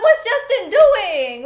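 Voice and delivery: high, loud and slow, laughing in disbelief; incredulous laughing exclaim. Retold or imitated. In the car, wondering at her husband, laughing high and loud. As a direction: squeal it out, amused and baffled. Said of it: "I'm like".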